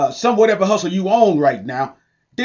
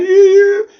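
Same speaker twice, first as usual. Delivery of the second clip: excited yelp; 6 dB louder than usual. Real, not acted. Hyped aside about homeless folks.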